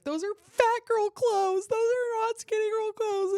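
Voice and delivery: put-on high, loud whiny teen voice; mock bratty teenage whine; a petulant teenager sulking at her mom; imitating her whiny teen self. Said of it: "I'd be like".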